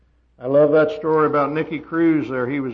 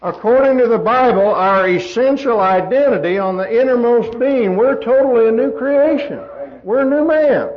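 loud, higher-pitched preaching; khotbah berapi-api; 6 semitones higher, 8 dB louder than usual. Acted. Preaches at full force.

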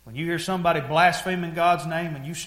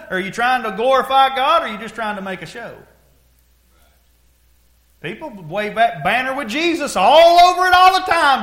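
high, loud exhortation; raised, hammering sermon delivery; full-force preaching; 9 semitones higher, 10 dB louder than usual. Real, not acted. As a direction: preach it hard at the congregation.

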